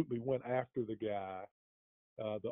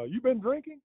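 pitched-up accusing cop question; imitating the cop; 11 semitones higher than usual. Retelling the cop asking if he'd been drinking, mock accusing. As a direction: play the suspicious officer sniffing for trouble.

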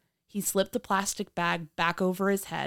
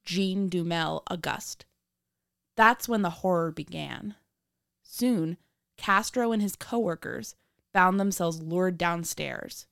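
true crime narrator; 10 semitones higher than usual. A narrator's reading.

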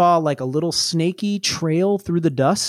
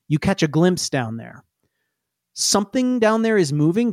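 normal talking voice

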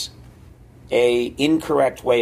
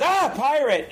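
high, startled mock yelp; hammed-up fright, pitched way up; scared dean. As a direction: blurt it in comic alarm. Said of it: "the other being scared".

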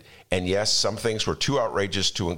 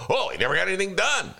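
mocking centrist friends; 12 semitones higher, faster than usual. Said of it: "And then you go".

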